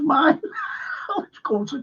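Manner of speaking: high, amused telling that breaks into laughter; tickled by your own story; laughing. Real, not acted. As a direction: laugh through the confession. Laughing at his own naive mistake.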